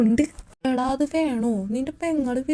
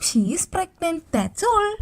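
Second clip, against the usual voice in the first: higher, lightly voiced doctor breaking the news; mock doctor voice; 6 semitones higher, 3 dB quieter, much slower than usual. Imagining the doctor telling Justin, lightly voiced doctor. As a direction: play the doctor casually, a bit higher. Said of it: "അപ്പൊ doctor പറയും".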